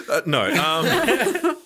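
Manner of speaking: sharp high gasp then stammer; a hammed-up horrified gasp; shocked gasp. Shown as a demonstration. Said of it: "I think I go a bit like this".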